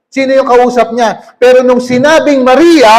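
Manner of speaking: usual speaking voice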